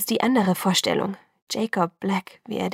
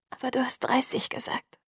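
voiceless whisper, pitched up; tearful whisper; 5 semitones higher, 5 dB quieter than usual. A narrator's reading. Bella whispers her reproach to Edward through tears. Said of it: "flüsterte ich".

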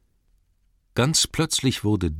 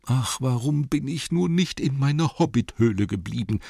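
higher-pitched, plaintive hobbit voice; lamenting hobbit; 6 semitones higher than usual. A narrator's reading. Poor hobbit jolted on a dwarf's back, lamenting. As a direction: wail mildly like a sorry little hobbit.

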